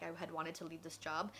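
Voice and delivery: ordinary talk, nothing marked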